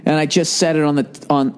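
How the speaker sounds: ordinary delivery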